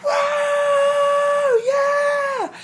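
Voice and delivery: high, loud concert whoop; long mock crowd scream; cheering crowd. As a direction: imitate an audience screaming as lights go down. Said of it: "everybody was just like".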